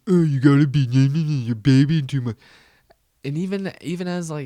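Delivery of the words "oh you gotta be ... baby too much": loud, higher garbled caveman dad; mocking dad voice. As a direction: mom mimicking dad, mocking inarticulate caveman voice. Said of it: "He doesn't talk like that".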